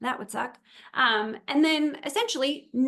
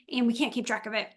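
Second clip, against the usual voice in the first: usual speaking voice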